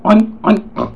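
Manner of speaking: high, loud oinks; pig oinks. Shown as a demonstration. Teacher showing the class a pig oinking, high and loud. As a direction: squeal it out like a pig. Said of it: "grunts or oinks".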